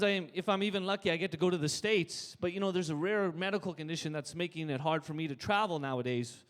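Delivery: ordinary talk, nothing marked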